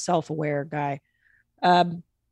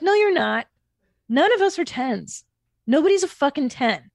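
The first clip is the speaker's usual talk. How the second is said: mock interviewer scoffing, higher; scoffing interviewer, pitched up; imitating the interviewer; 6 semitones higher than usual. Host to self-rated ten, scoffing higher.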